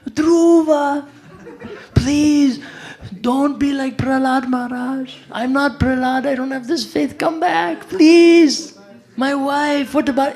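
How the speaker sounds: very high, loud panicked pleading; shrieking plea to a friend walking into the jungle; terrified scream. Retold or imitated. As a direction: scream it like he's begging for his life. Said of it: "and Damodar is screaming".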